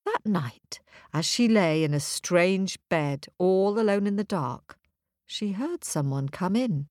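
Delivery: plain reading voice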